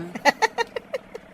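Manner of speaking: high laugh. Acted. Amused laughter at S0's laugh.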